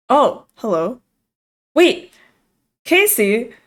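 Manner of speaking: sudden high startled recognition; surprised. Real, not acted. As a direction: caught off guard, loudly. Recognizes old friend at door.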